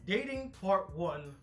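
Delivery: slow, drawn out announcement; slow title read. Real, not acted. Repeats it slowly as a title.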